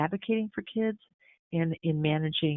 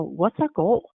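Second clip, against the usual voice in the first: ordinary talk, nothing marked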